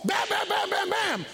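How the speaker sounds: high, forceful volley of bams; imitated banging. Preacher mimicking the useless banging on the door, high and forceful. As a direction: hammer out each bam with the voice. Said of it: "All the human effort we put forth".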